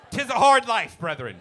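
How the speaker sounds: loud, high hammed-up old-timey preacher; booming mock pious farmer. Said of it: "Start talking like that".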